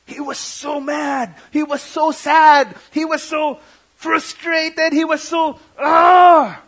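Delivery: slow, high lines rising to a loud ah; building anguish to a cry. Real, not acted. Preacher feeling Jesus' grief at Lazarus' tomb, slow and high, ending in a loud cry. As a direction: let the anguish swell until it bursts out.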